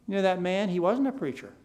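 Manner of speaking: ordinary delivery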